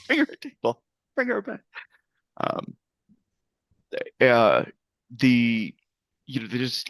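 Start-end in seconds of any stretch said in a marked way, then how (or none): 0.1-1.6 put-on high-pitched, bossy little girl; small child taking charge, pitched way up; bossy ordering. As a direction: order the grown-ups about in a high voice. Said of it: "bossily ordering somebody larger than her".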